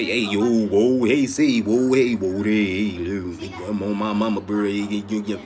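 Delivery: loud, rowdy mock street talk; hammed-up street caricature. Mocking stereotype of New Yorkers.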